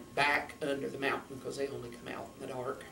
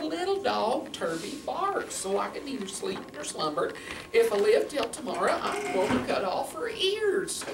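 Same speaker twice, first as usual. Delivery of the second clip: put-on grumbling old man; 9 semitones higher than usual. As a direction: complain in a raised, swooping voice. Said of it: "He said".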